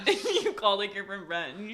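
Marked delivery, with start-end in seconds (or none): none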